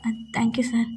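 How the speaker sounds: a sheepish aside nobody else should hear; whispered thanks. Retold or imitated. Assistant whispers thanks to the boss beside him. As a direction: breathe the thanks under the breath.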